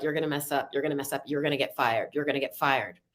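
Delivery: low, muttered nagging inner voice, a hint of a British man; inner critic Bob. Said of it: "and he is a British man".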